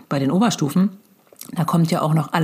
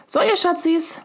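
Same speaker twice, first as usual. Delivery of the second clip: sugary mom voice; 11 semitones higher than usual. Retold or imitated. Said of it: "sagst du".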